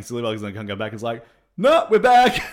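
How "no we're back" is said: high, shouted surprise return; loud announcement. Retold or imitated. Prankster imagined returning to the cinema, loud announcement. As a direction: burst back in and shout it.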